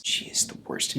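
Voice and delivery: whispered, unvoiced; whispered aside. Host whispering an aside about her assistant. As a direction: whisper it behind her back.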